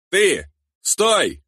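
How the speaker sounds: high sharp shout to halt someone. A narrator's reading. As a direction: yell it out high. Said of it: "выкрикнул Бек".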